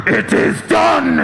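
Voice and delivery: slow, high, booming proclamation; dark sorcerer shout. Pretend. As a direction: shout it like an evil wizard's ritual. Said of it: "this is the noise I make when I come".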